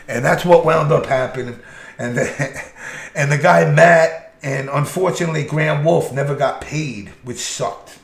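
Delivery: normal talking voice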